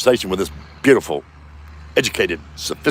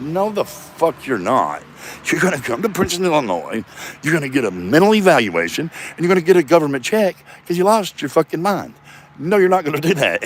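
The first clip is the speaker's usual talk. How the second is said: higher-pitched, emphatic telling-off; acted sister's scolding; 6 semitones higher than usual. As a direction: lay down the law as an exasperated sister.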